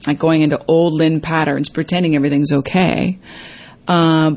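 usual speaking voice

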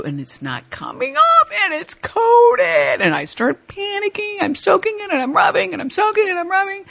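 high, frantic panic; panicking. Retold or imitated. Scrubbing the glued knife, high panicky voice. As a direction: squeak it out in rising panic.

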